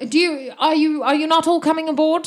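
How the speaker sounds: high, loud questioning call; boatwoman's voice. Acted. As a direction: call up in a put-on woman's pitch. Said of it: "She's, uh".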